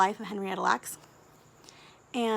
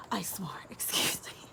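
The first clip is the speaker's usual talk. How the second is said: a gasp, then a soft whispered apology; embarrassed whisper; 3 semitones lower, 7 dB quieter than usual. Real, not acted. Vlogger apologizing to viewers for swearing, whispered and giggly. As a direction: caught herself swearing, hushed giggle. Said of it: "I told myself I wasn't gonna swear".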